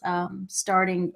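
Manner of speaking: ordinary talk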